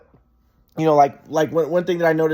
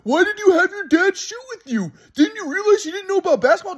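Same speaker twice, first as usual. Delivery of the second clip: high, louder mocking grandpa voice; imitating grandpa; 11 semitones higher, 3 dB louder than usual. Grandson mimics grandpa questioning his dad's help, mocking. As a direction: needle him like the grandpa did. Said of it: "my grandpa goes".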